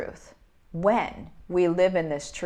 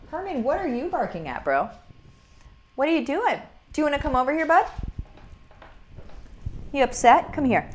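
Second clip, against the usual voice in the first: higher, coaxing pet-talk voice; talking to the dog; 8 semitones higher, 3 dB quieter, faster than usual. Real, not acted. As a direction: coax the dog over to you, sweet and high. Talking to her barking dog.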